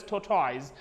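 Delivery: "tortoise" ending like toys, tor-TOYZ; mispronounced tortoise. Retold or imitated. Teacher quotes common mistake, tortoise said as tor-toyz. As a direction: rhyme the end of tortoise with boys. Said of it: "Very many people pronounce as".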